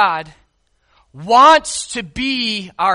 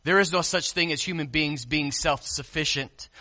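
ordinary delivery

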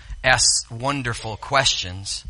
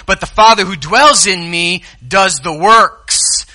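raised, emphatic scripture voice; Jesus urging Philip to believe; Jesus teaching; 7 semitones higher, 8 dB louder than usual. Acted. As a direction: press the point home. Preacher voicing Jesus' words.

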